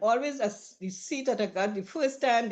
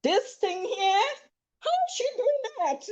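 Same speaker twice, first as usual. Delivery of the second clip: high, slow amazed whisper of shock; shocked onlookers; 13 semitones higher than usual. Retold or imitated. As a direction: sound stunned, as if you can't believe it. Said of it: "watching shock like".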